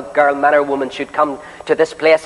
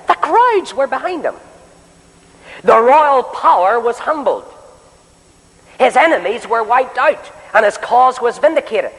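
fervent preaching; 9 semitones higher than usual. Acted. Preacher rousing the meeting about Elijah, fervent and raised. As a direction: lift the hall with rising preacher cadence.

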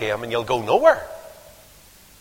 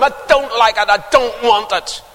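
spoilt brat; 8 semitones higher, 6 dB louder than usual. Retold or imitated. Spoilt boy's tantrum.

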